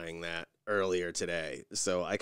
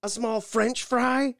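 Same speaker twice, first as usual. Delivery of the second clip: much higher, louder, slow sarcastic question; mock incredulous cashier; 8 dB louder, slower than usual. Cashier mocks the fry order back, slow and high. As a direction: drag it out like she can't believe it. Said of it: "And she goes".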